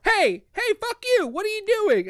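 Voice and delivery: high-pitched, outraged challenge; indignant mock yell. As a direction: call out a thief in comic outrage. Hero confronts thief in retelling.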